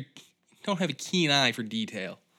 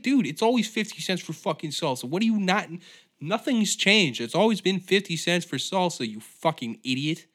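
raised, higher exasperated telling-off; retold yelling back; 6 semitones higher, 4 dB louder than usual. Telling off a customer over salsa, loud and exasperated. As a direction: re-enact chewing out a rude stranger. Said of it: "I once yelled at a guy".

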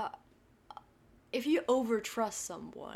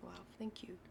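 whispering; 12 dB quieter, faster than usual. Real, not acted. Partner's compliment, whispered reply.

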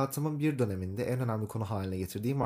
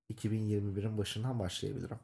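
normal talking voice

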